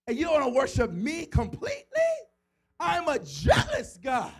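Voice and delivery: God's voice rising from hushed warning to loud jealous shout; angry God. Acted. Preacher voices God's jealous anger.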